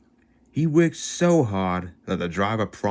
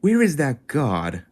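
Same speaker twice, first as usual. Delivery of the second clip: higher, slow worried ask; puzzled crewman wondering aloud; anxious driver; 7 semitones higher, 3 dB louder, slower than usual. Acted. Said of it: "the driver and fireman asked".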